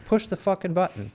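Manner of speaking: mock fanatic. Mocking a reckless nuke holder.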